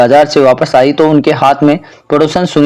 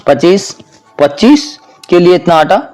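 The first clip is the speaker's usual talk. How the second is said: incredulous; 4 semitones higher than usual. A narrator's reading. Mother-in-law questioning her daughter-in-law's flour, incredulous. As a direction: pitch jumps higher in shocked disbelief.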